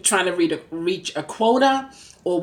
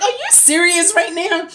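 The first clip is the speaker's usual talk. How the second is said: much higher, incredulous question; can't believe what you just heard; 12 semitones higher, 4 dB louder than usual. Retold or imitated. Disbelief at the trooper.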